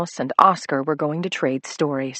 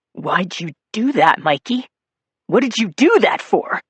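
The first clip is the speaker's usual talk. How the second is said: rising into a louder, higher angry demand; angry Nettie; 3 semitones higher, 4 dB louder than usual. Acted. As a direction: build from a hurt question to a shout. Nettie confronts Mikey.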